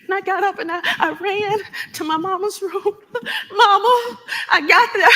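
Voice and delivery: breathless rush to the room; panicked daughter shouting for Mama; rising panic. Acted. Running to mother's room.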